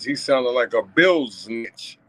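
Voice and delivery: "bill snitch" drawn out slowly in his own slang way; slang pronunciation. Shown as a demonstration. A vlogger insults the boyfriend, saying "bill snitch" slowly. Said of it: "if you listen to how I said it".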